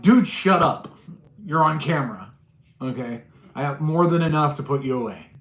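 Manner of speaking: loud, stern "Dude, shut up"; scolding a trespasser on camera; angry telling-off. Retold or imitated. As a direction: shut him down hard.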